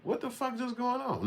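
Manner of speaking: high soft bewildered mock voice; mock disbelief. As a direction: squeak it out in shock. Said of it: "and you like".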